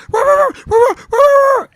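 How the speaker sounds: shouted high barks, imitating a girl doing a dog; mock dog barking. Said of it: "She kept doing the fucking dog bark".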